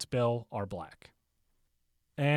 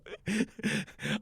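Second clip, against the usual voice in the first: short laugh; 3 semitones higher, 3 dB quieter than usual. Real, not acted. Laughs, declining to do an accent.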